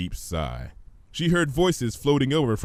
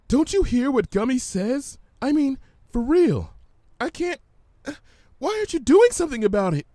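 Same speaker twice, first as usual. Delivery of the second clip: higher, urgent, rushing at the end; anxious Fluttershy; 14 semitones higher, 5 dB louder than usual. Acted. Fluttershy presses Pinky about Gummy, anxious and higher. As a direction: plead with growing worry.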